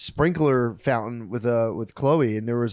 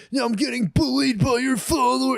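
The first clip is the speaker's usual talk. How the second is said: mock whiny reporter; 12 semitones higher than usual. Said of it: "They're like".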